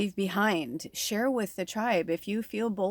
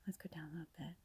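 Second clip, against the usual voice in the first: a real whisper, much softer; 22 dB quieter, much faster than usual. Real, not acted. Whispering to viewers while moving the camera down. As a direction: breathe the words out, no voice at all.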